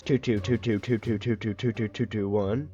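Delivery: rattled-off string of identical words on one pitch; monotone rattle. Shown as a demonstration. Showing viewers an absurdly long number, monotone rattle. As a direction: drone through the repeats mechanically without a break.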